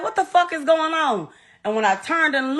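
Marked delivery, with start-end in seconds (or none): 0.0-1.3 raised pitch, alarmed retelling; retold alarm. Mother recalling locking the door, retold alarm. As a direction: relive the confusion, higher voice.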